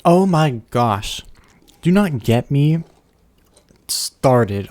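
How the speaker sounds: loud, drawn-out exasperation; exasperated outburst. Real, not acted. Ranting to chat about the Pringles logo, loud exasperated outburst. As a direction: blow up over the logo change.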